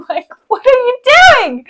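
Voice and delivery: high laughing exasperation; laughing disbelief. Retold or imitated. Mocking the bystanders, laughing.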